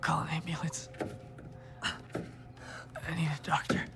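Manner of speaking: pained, breathy whisper, very slow; weak whisper. Acted. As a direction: barely get the words out. Weak man in pain.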